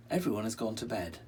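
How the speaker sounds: plain reading voice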